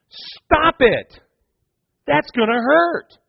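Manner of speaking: high, slowed warning voice; mock realizer. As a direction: call it out from afar. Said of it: "I'm thinking to myself".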